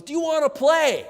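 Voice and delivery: put-on very high, loud little-kid shout; eager child. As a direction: shout it like an excited small child. Daughter standing on bed, voiced.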